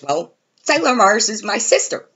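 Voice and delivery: imitating Justin. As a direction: say it like it's obvious. Justin's absurd claim, quoted.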